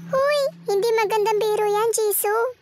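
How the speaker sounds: normal talking voice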